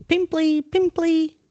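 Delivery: high mock chant; mocking yell. Peeves chasing Myrtle, mocking yell. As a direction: jeer in singsong.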